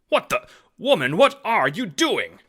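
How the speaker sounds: high, loud startled protest; indignant demand. A narrator's reading. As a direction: demand she stop at once. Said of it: "Jacob demands".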